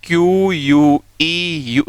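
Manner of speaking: letters क्यू यू ई यू spelled one by one, very slow; spelling out slowly. Shown as a demonstration. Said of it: "अब आप spelling देखो". (अक्षर-अक्षर धीरे-धीरे बोलना)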